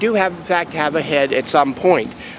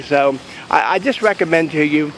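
ordinary talk, nothing marked

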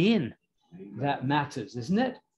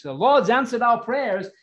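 higher, louder burst of joyful news; glad excitement; 7 semitones higher, 6 dB louder than usual. Acted. Woman runs to tell the praying saints, glad and excited. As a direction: announce it in excited gladness. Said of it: "in her gladness and excitement".

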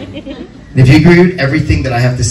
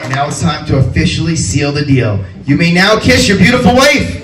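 higher and higher, building to a shouted announcement; grand announcer; 12 semitones higher than usual. Acted. Officiant pronouncing the couple husband and wife to the guests, building to an announcer's shout. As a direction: proclaim it like a ring announcer hyping the crowd.

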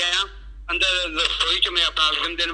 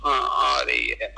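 low, slow half-asleep mumble; drowsy murmur of contentment; sleepy murmur; 6 semitones lower, slower than usual. Retold or imitated. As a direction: drift off while saying it. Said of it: "ਸੁੱਤੇ ਸੁੱਤੇ ਵੀ ਹੱਥ ਸੁੰਘਦਾ ਰਿਹਾ".

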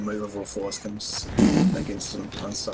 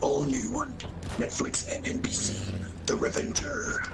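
whispered mock movie trailer. Friend whispers a fake Netflix trailer. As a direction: hype it in a hushed whisper.

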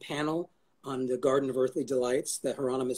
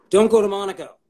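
louder and higher, punching go; emphatic; 6 semitones higher, 9 dB louder than usual. Real, not acted. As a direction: hammer the advice home. Repeated with emphasis.

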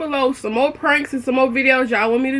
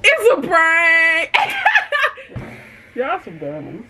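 high bursts of laughter; laughing; 4 semitones higher, slower than usual. Real, not acted. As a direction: laugh at the fooled friends. Reveals prank, laughing.

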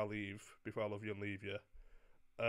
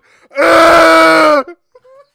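screaming laugh-cry; 29 dB louder than usual. Pretend.

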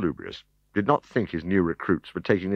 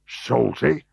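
shocked, high rising Salty?; gasped; 5 semitones higher than usual. Acted. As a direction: gasp out Salty? in disbelief. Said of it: "gasped Fatuus".

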